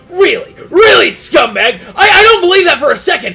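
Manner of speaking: loud, scornful yelling; angry shout. Retold or imitated. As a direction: Bastion yelling at the hero he calls a scumbag. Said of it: "He's like".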